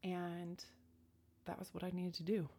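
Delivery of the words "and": normal talking voice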